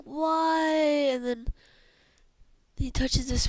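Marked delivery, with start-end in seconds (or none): 0.0-0.7 high, drawn-out plea; acted cry of despair; anguished cry. Doomed character cries out why.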